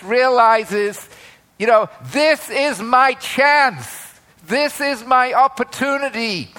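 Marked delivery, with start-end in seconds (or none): 2.1-6.5 urgent realization. Acted. Beggar realizes his one chance.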